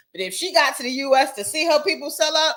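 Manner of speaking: ordinary talk, nothing marked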